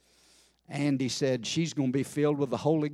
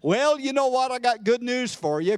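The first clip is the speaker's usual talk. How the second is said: usual speaking voice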